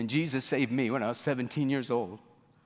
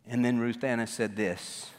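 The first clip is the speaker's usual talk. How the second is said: ordinary delivery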